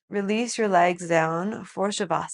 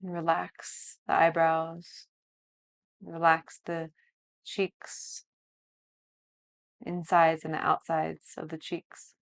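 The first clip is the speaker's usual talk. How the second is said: softer, slow body-scan guidance; guided meditation; 8 dB quieter than usual. Acted. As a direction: lead a calm body scan.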